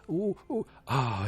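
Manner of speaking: tense gasp. Retold or imitated. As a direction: squeak it out like watching through your fingers. Viewer's tense gasp at the scene.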